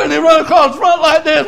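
loud, high revival storytelling; fired-up country preacher; full-force preaching. Real, not acted. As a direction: holler the tale out, pitched high.

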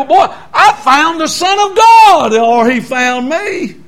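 slow, high, wondering proclamation; joyful announcement. Retold or imitated. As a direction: announce it with wide-eyed joy. Retelling his joyful announcement.

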